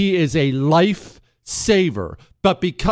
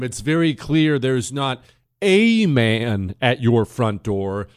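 ordinary talk

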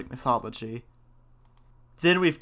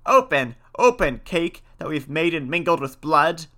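louder witches' demand; crying out; 3 semitones higher, 5 dB louder than usual. A narrator's reading. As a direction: call out as witches demanding entry. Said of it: "they cried again".

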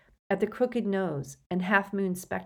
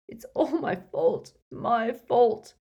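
higher, anguished self-blame; sobbing; 5 semitones higher, 5 dB louder, slower than usual. A narrator's reading. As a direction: wail it out tearfully. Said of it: "he sobbed".

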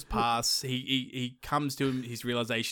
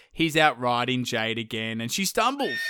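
usual speaking voice

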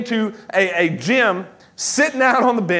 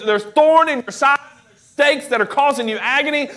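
ordinary talk, nothing marked

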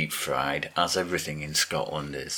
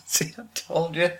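laughing incredulity; 11 semitones higher than usual. Real, not acted. Co-host mocking the failed Scottish accent, laughing incredulity. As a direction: squeal it through laughter.

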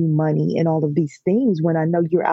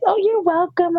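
sweet coo; 13 semitones higher than usual. Retold or imitated. Teasing boy who said thank you.

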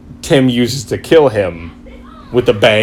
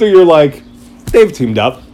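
ordinary talk

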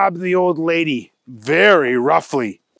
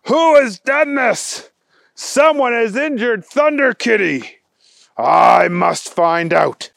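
dramatic resolve; 6 semitones higher, 4 dB louder than usual. Acted. Agent vows to investigate.

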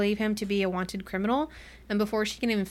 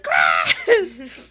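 loud, high, drawn-out comic holler; mock scream; 6 semitones higher, 7 dB louder than usual. Man running down the hall for Cress, mock screaming. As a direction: holler the name while half laughing. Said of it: "screaming his head off for Cress".

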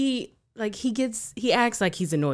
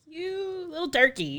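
higher, slow teasing scold; high affectionate scold; 12 semitones higher, slower than usual. Retold or imitated. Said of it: "I'm like".

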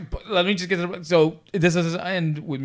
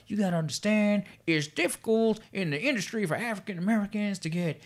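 higher-pitched, botched celebrity impression slipping toward Southern; Bill Cosby; 5 semitones higher, 4 dB quieter than usual. Star lecturing young extra on industry, badly faked Cosby voice. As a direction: attempt the Jello Pudding Pop voice, badly. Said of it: "like, the Jello Pudding Pop voice".

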